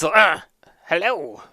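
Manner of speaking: mildly startled greeting; little yelp, then a hello. Acted. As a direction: caught off guard, lightly acted. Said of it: "He's startled when he says".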